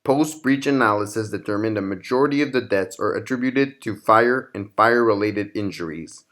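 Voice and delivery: ordinary delivery, nothing marked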